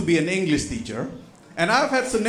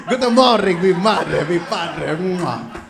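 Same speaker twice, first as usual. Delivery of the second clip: put-on Italian accent; 5 dB louder, slower than usual. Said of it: "I was the one who taught that kid".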